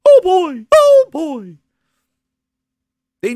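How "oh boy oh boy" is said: very high, loud, goofy mock-gushing voice; hammed-up falsetto excitement; Phil Spencer. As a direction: squeal like an over-excited celebrity showing off. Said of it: "Phil Spencer comes out".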